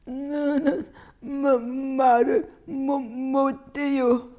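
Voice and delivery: put-on high, slow, stammering little child's whimper; whimpering child tripping over each word; tearful stutter. A narrator's reading. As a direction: stutter through it like a child about to cry. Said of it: "엄마에게 울먹이며 말했다".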